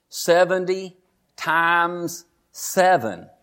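slow, emphatic. Acted. Preacher quoting Jesus's answer on forgiveness, slow and emphatic. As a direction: stretch out each word slowly.

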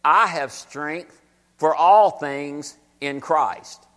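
very slow, low verse reading; slow scripture reading. Acted. Preacher reading Philippians slowly to the church.

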